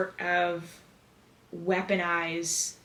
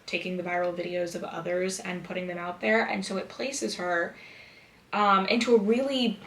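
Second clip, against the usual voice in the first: ordinary talk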